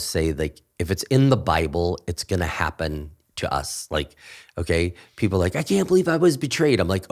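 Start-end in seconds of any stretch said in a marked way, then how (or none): 5.6-6.8 higher, whiny mock complaint; mock complainer. Mimicking people who feel betrayed, whiny. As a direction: imitate a self-pitying churchgoer.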